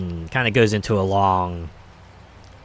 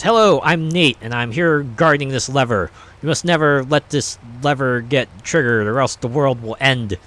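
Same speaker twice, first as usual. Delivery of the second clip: snake voice; 5 semitones higher, 5 dB louder than usual. Acted. Snake character introduces itself.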